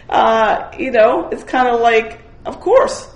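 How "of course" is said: high, loud "of course"; emphatic of course. Real, not acted. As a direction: throw it out as obvious. Emphatic exclaim.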